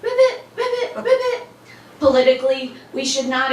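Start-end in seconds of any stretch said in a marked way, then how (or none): 0.0-1.5 very high, squeaky little frog; high-pitched puppet frog; Baby Ribbit. Pretend. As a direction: croak ribbit in a tiny high voice. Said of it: "Baby Ribbit's the one talking".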